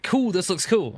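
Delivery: a quick, high-pitched send-up of approval; easily pleased editor. Retold or imitated. Careless editor okaying the music, mock easily pleased.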